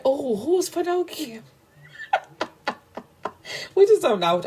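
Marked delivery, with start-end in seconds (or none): 0.1-1.4 high, fake-innocent question; petty mock innocence. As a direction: play dumb, sweetly petty. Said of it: "Cam is so petty".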